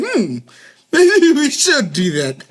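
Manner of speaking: pondering scientist. Retold or imitated. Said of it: "the scientist was like".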